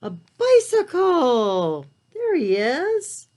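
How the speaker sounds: big storytime reveal; excited reveal. Acted. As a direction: announce the answer like a happy surprise. Reveals page answer to children.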